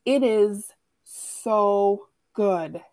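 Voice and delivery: slow, stretched rave; drawn-out gush. Real, not acted. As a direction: lean into every word. Gushing about her book pick.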